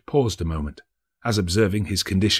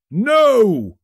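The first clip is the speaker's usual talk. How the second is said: a much higher, louder "No!"; hammed-up loud denial; blunt exclamation; 8 dB louder than usual. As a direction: fat merchant cutting in loudly.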